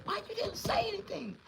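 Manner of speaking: high, shrill scolding mother's voice; a mother scolding her son in alarm. Retold or imitated. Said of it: "I just heard her say".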